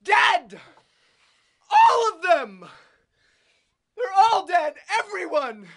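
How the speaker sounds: very high, very loud frenzied yell; shouting. Acted. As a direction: scream it like a madman with a Tommy gun. Pill guns down germ funeral.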